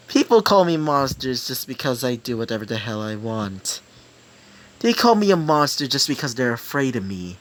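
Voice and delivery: normal talking voice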